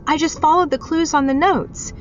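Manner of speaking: high, loud and flustered. Acted. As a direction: defend yourself in a panic. Flustered defence.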